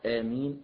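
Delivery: آمين with the first alif held for two counts. Shown as a demonstration. Teacher showing the shortest alif in آمين, brisk. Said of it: "ممكن يقول".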